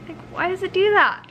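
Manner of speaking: high frustrated whine; exasperated. Real, not acted. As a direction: complain in disbelief. Exasperated at hair.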